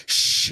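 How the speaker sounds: sharp unvoiced shush; imitated shushing. Audition crowd to noisy mom, imitated shush. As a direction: shush her like a whole crowd. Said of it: "shushed at one time at an audition".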